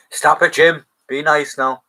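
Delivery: mock scolding. Teasing co-host Jim after his joke, a mock loud telling-off. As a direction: scold Jim like a naughty kid, half joking.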